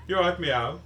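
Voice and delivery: put-on loud, high-pitched Birmingham accent; imitated Brummie greeting. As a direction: mimic a Brummie shopkeeper calling hello. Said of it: "in Birmingham or Dudley".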